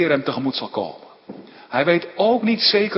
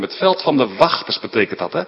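ordinary talk, nothing marked

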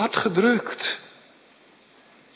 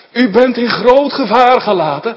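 urgent warning; 6 semitones higher, 8 dB louder than usual. Retold or imitated. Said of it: "dat is wat hij heeft gezegd".

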